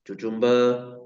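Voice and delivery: cucumber said as "tuchumber", with a ch in the middle; joking mispronunciation. Shown as a demonstration. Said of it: "it's pronounced as cucumber".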